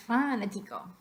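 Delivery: fanático with a drawn FÁ at the start; wrongly stressed FÁnatico; stress on first syllable. Shown as a demonstration. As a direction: hit "fa" in fanático and hurry the rest. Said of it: "I can hardly even say that".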